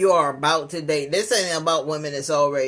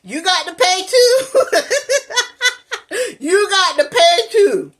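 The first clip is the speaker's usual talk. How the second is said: high, loud, cracking with laughter; hammed-up demand breaking into laughs; laughing mock scold; 9 dB louder than usual. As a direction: cackle through the mock demand. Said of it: "They be like".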